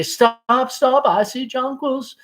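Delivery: thrilled at spotting flowers; excited call. Retold or imitated. Girl spots jonquils on a ride, excited. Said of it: "I'd say".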